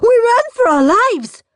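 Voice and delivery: put-on high, panicked child's wail. A narrator's reading. Gordon tells the parents, high frightened wail. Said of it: "wailed Gordon".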